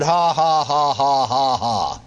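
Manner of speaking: mechanical ha-has stepping down; forced laugh. Retold or imitated. Sick man answers devil with forced laughter. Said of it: "flat made myself do it".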